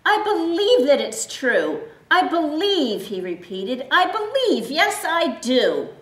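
very high, loud joyful cry; donkey overjoyed to believe; bursting out. Acted. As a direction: burst out with sudden faith. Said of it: "The donkey burst out".

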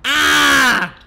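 loud high scared yell; startled shout. Real, not acted. Player yelling at the killer, startled shout. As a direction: yelp as the killer hits.

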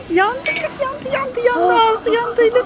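high, teasing exclamations; mother playing along as the child burns her mouth; playful alarm. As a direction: tease the child about the hot tea. Playful hot tea alarm.

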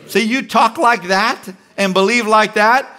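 normal talking voice

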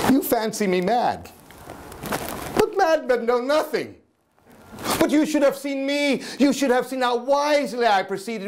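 high, excited gloating; manic boast. Acted. Madman boasting to listeners, high and gleeful. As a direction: brag with rising glee.

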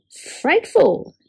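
high whinnying exclamation; neighing horse. Acted. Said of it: "neighed Horse".

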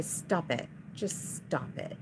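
hushed exasperated whisper at the camera; muttered stop it. Real, not acted. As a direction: mutter it under your breath.

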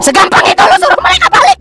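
high, loud mocking cow voice; loud high cow voice jeering; cow character voice. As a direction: scoff as the cow. Cow character mocks him.

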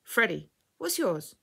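high-pitched eager lad; child's voice, lifting on the question; little boy. A narrator's reading. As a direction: answer brightly as a small boy. Little lad answers her.

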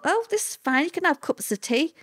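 high-pitched thought of sudden relief; relieved surprise. Retold or imitated. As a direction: lift it up, pleasantly surprised. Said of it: "But then thought".

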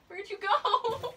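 high, slow, laughing; laughing search. Real, not acted. Searching for the hidden lizard, slow laughing question. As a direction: laugh through the question as you look.

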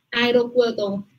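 ordinary delivery

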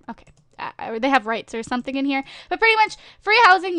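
ordinary delivery

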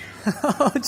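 a quick high burst of laughter; tickled high laugh; high giggle. Real, not acted. Laughing at the crying kitten.

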